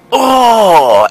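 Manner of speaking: high, loud disgusted gasp of a word. Retold or imitated. Kid recoiling from the stink, loud and high. Reacting to horrible fart smell.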